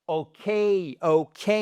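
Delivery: 'ok' with a strong, gliding 'kay'; stress on K. Shown as a demonstration. Teacher modelling English for French students, stressed 'kay'. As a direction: punch the second syllable of 'ok'. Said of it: "vous avez un accent tonique sur le K".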